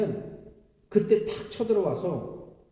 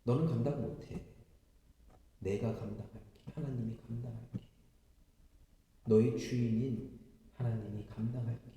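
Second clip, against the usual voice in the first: God's reassuring voice; 7 semitones lower, 8 dB quieter than usual. Retold or imitated. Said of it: "하나님이 감당하시겠다라는".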